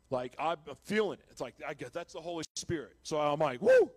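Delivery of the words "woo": very high, loud whoop of joy; joyful whoop. Retold or imitated. Filled with the Holy Spirit in his cell, an ecstatic loud woo.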